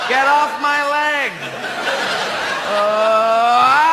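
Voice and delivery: hammed-up cries for help. Astronaut attacked by a monster, hammed-up screams for help. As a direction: ham up being attacked.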